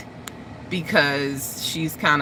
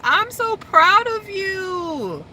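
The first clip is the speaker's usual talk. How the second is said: high, drawn-out gushing squeal; re-enacted proud squeal; overjoyed pride; 14 semitones higher, much slower than usual. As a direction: gush slowly at the top of your voice range. Retells congratulating friend on birth.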